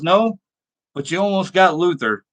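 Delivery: ordinary delivery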